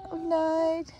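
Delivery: high, drawn-out "oh no"; dismay. Real, not acted. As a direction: let dismay lift the pitch. Animal will not come over. (bestürzt, hoch gezogen)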